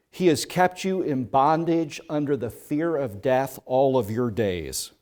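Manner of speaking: usual speaking voice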